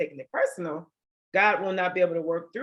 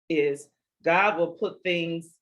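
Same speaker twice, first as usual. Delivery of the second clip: normal talking voice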